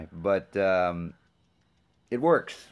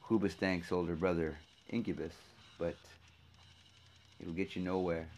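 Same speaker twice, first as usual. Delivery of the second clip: normal talking voice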